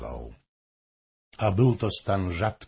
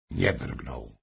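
usual speaking voice

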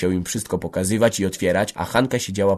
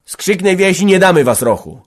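high, loud threatening protest; fierce defiance; 11 semitones higher, 9 dB louder than usual. A narrator's reading. Michał vowing to defend Rocho, fierce shout. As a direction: bellow it ready for a fight. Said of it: "wstrożył się Michał".

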